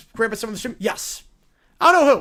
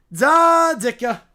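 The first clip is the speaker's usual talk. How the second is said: drawn-out, booming Chicago "da Ditka"; Chicago superfan; 5 semitones higher, 9 dB louder, much slower than usual. Pretend. Host signs off to fans in a Chicago superfan voice.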